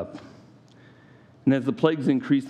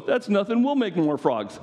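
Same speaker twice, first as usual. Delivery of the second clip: mock boast; 8 semitones higher than usual. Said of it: "the magicians go".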